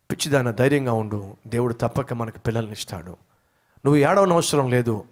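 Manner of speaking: soft, low, consoling husband; tender comfort. Retold or imitated. Husband soothing his weeping wife, soft and tender. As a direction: gently hush her tears.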